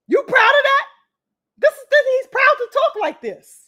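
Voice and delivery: outraged. Real, not acted. Outraged rhetorical question.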